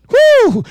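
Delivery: high whooping exhale; breathy high whew of fervor; sharp high whoop. Real, not acted. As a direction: let out a sharp preacher's whew. Preacher's exhale in climax.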